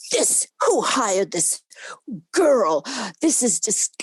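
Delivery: retold outrage. Boss objecting to a girl on his crew, acted outrage. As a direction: act out an appalled boss, stretching the words. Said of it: "went crazy. He said".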